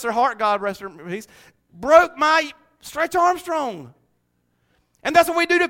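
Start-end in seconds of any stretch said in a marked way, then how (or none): none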